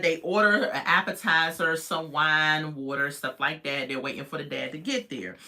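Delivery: normal talking voice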